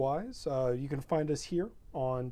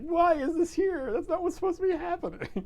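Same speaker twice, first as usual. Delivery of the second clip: high mock bewilderment, pitched way up; mock puzzled; 5 dB louder than usual. Players finding the letters, voiced in high mock confusion. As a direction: act baffled by a stray curly R and Z. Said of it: "It's like".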